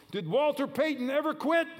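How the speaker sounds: high, loud coach's challenge; coach shouting. Retold or imitated. Coach challenging his players in the locker room, shouting. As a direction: fire the question at the team like a coach.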